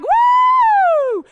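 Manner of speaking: high, loud whoop of joy; kids' cheer. Retold or imitated. Mom mimicking kids cheering for McDonald's, loud whoop. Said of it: "everybody's like".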